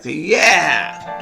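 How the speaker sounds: long drawn-out, rising-falling yeah; mock cheer. Solver voicing a crowd's answer, long mock cheer. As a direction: cheer it out in play. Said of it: "and they're like".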